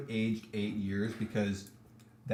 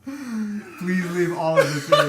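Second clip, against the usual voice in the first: laughing; 8 semitones higher, 7 dB louder, much slower than usual. Real, not acted. Begging through laughter.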